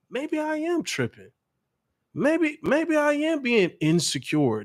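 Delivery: put-on high, slow, whiny doubting boyfriend; slow falsetto second-guessing; mock self-doubt.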